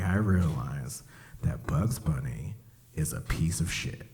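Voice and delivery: slow, hushed secret; soft slow confession; conspiratorial whisper. Real, not acted. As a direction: lean in and whisper the verdict.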